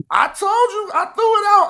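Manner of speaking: high-pitched, exclaiming protest; exasperated I-told-you-so. Real, not acted. Reminding the other man of an earlier warning, exasperated and high-pitched. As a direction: voice shoots way up in vindication.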